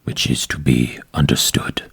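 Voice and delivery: low, hushed menacing captor; low orc captor voice. Acted.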